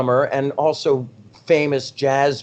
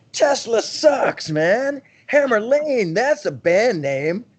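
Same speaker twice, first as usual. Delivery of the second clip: high, slow mock rocker-dude voice; mock cocky rocker; 10 semitones higher, slower than usual. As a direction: ham up the know-it-all rock guy. Retelling dismissing the name Tesla.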